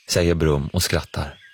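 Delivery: ordinary delivery, nothing marked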